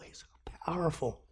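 hushed, breathless whisper of awe; awed whisper. Real, not acted. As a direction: drop to a whisper in reverence. Awe at God's greatness.

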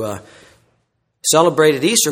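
ordinary delivery

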